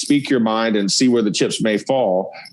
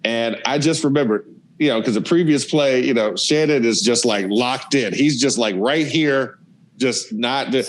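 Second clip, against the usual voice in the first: usual speaking voice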